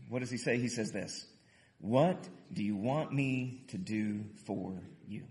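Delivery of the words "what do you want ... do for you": slow, low and soft; read-along pace. Shown as a demonstration. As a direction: lead a group reading, word by word. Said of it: "read this next question with me".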